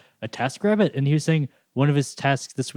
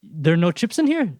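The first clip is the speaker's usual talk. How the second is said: mock outrage; 6 semitones higher, 4 dB louder than usual. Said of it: "And it's like".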